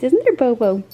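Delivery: high sing-song pet voice. Real, not acted. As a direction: coo at the dog. Talking to the dog.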